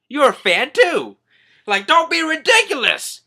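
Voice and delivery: loud, high comic exclamation; indignant outburst. Retold or imitated. Minister accused of being a fan, loudly indignant. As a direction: burst out in mock disbelief.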